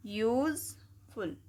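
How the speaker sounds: Useful pulled apart slowly, syllable by syllable. Shown as a demonstration. Teacher splitting a word into syllables for the class, slow. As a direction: sound out useful slowly in parts. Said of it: "तोड़ के पढ़ते हैं हम".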